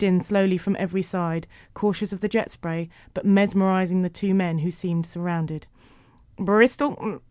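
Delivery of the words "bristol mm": spliced tape playback. A narrator's reading. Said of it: "went the tape recorder".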